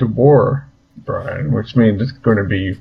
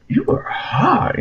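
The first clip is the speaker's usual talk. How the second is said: high, slow, leering you are hot; mock Negan flirting; 12 semitones higher, 4 dB quieter, slower than usual. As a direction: leer it out slowly. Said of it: "he just like".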